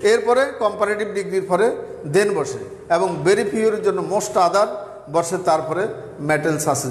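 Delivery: ordinary talk, nothing marked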